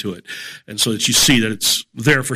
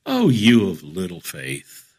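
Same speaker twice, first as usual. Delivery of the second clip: low, slow, measured rebuke; Jesus rebuking Peter; 5 semitones lower than usual. Acted. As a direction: drop low and slow for a calm reproof. Jesus gently rebukes panicking Peter.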